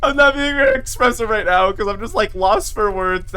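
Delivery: ordinary talk, nothing marked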